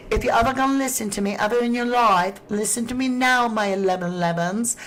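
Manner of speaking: rally them in a badly faked British accent; fake accent. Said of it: "I just did that 'cause y'all love my fake accent".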